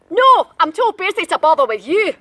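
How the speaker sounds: loud dismissive brush-off; loud snooty gingerbread man; gingerbread man refusing. Acted. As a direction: wave him away, busy. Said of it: "said the gingerbread man".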